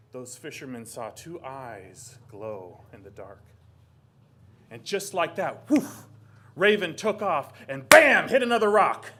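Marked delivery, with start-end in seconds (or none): 4.8-9.1 loud, high burst of action; excited narration. A narrator's reading. Narrator telling Raven slamming into a rock, loud and excited. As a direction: punch out the crash sounds.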